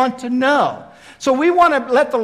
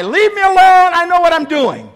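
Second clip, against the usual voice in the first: loud, high, indignant brush-off; hammed-up huffy retort; mock brush-off; 9 semitones higher, 9 dB louder than usual. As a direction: snap it back like an offended man. Mock wrong answer for Jesus.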